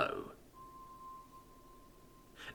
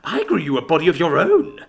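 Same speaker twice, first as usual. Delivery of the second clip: grinning; 4 semitones higher, 7 dB louder than usual. Acted. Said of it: "with a huge smile".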